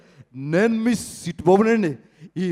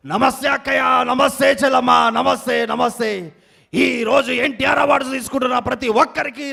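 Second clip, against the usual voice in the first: louder, higher sing-song greeting and shouting; rally politician; 4 semitones higher, 4 dB louder than usual. Acted. Mimic greets sisters and urges brothers to clap, louder. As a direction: whip up the crowd like a party leader.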